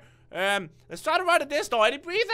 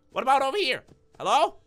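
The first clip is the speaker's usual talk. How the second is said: ordinary delivery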